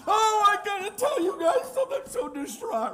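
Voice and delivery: very high, loud mock sob; fake distraught crying. Gen Z livestreamer performing grief, hammed-up wail. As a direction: bawl theatrically for an audience. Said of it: "see how I'm crying".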